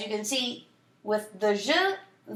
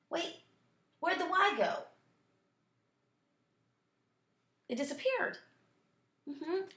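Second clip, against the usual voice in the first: mock surprise; 4 semitones higher, 6 dB quieter than usual. Teacher feigns surprise at missing Y.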